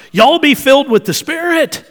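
loud, high Texas holler; Southern twang. Shown as a demonstration. Preacher giving the Texas version of the command, loud Southern twang. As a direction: shout the command in a big Southern accent. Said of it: "So this is the way that would sound".

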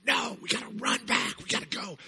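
put-on high, hushed kid panic; panicky kid voice. Storyteller imitating kids begging to run away, high and hushed. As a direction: play a frightened kid urging escape.

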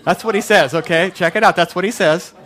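loud, emphatic "That's what he says"; preacher joking with the crowd, loud and emphatic; loud insistence. Real, not acted. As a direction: shout it out for the room.

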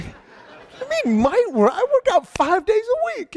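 high-pitched mock-outraged protest; indignant. Patient retorting to the doctor's insult, indignant. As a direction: squeal it out, comically offended.

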